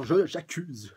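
a touch of a hammed-up accusation; mild mock outburst of blame; accusing cry. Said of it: "elle l'accuse directement".

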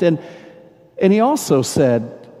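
ordinary delivery

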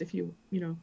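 hesitant, petering out into silence; trailing off. Acted. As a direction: let the request fade away unfinished. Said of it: "I just kinda, like, trail off".

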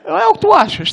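high, loud disbelieving laugh-question; can't believe what he hears; incredulous. Retold or imitated. Driver laughing at his friend's logic, high disbelief.

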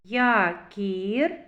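Якір with a long, rising І; drawn-out І. Shown as a demonstration. Teacher picks out the second vowel, І drawn out. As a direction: stretch the І in Якір. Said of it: "і голосний".

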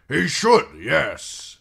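high, loud, oily flattery; fawning courtier. Acted. Janos fawns again.

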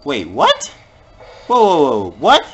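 loud, startled burst at the screen; startled whoa. Real, not acted. Gamer startled by a fast enemy, loud surprised whoa. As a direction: caught off guard, blurting it out. Sudden attack in the game.

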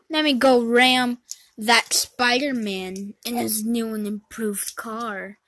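normal talking voice

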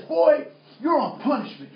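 loud, high punishing order; upset father. Retold or imitated. Upset dad sends his son to his room, angry. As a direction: imitate a dad who has had enough. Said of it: "my dad gets upset".

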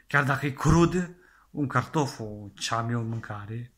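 usual speaking voice

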